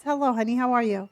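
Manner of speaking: normal talking voice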